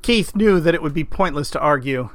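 usual speaking voice

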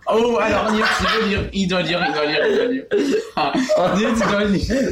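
laughing. Real, not acted. Laughing fit at friend.

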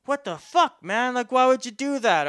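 high, loud "what the fuck, man"; outraged protest. Retold or imitated. After being whipped across the face, a high, loud outraged protest. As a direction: shout in shock at being hit.

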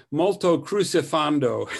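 hammed-up fake Italian musical term. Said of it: "we used to make up fake Italian".

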